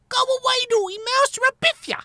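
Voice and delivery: put-on very high little baby voice; tiny squirrel. A narrator's reading. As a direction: threaten like a tiny child. Tiny squirrel threatens rat.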